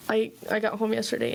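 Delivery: ordinary talk, nothing marked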